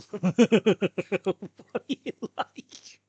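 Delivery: breathless laugh through the words. Real, not acted. Teasing someone in the chat, laughing. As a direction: wheeze it out high, through laughter. Cracking up mid-chat with viewers.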